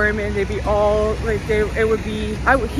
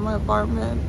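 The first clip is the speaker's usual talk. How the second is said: normal talking voice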